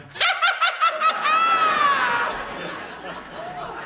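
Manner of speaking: very high stuttering cackle into a long shriek; acted sleep shriek. Girlfriend erupts out of nowhere, high maniacal shriek. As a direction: burst into a piercing, drawn-out mad noise. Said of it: "she just went".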